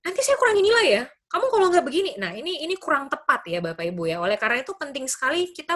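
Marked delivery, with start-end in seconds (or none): 0.0-2.2 high, quick scolding as a teacher; mock angry teacher. Teacher threatening to cut a student's marks, mock angry. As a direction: play a teacher issuing a threat. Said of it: "langsung marah-marah".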